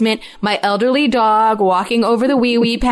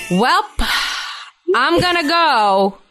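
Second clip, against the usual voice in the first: higher, drawn-out announcement; blunt little kid; 6 semitones higher, much slower than usual. Retold or imitated. Four-year-old bailing on a family video call, blunt announcement. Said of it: "he will be looking dead into camera and go".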